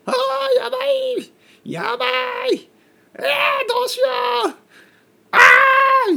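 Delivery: loud falsetto shrieks of mock panic; comic frantic meltdown; panicked screaming. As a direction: wail in hammed-up alarm, very high. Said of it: "そういう声を出します".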